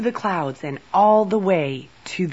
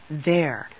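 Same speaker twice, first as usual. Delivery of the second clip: plain reading voice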